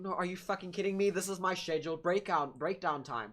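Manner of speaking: loud, hammed-up outrage; exasperated protest. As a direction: protest loudly, as if cheated of a breakdown. Acted protest at being sent back.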